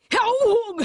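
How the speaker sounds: high, loud sudden yelp; startled cry. A narrator's reading. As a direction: burst out as the blow lands. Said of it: "not a scream, but a vast, startled grunt".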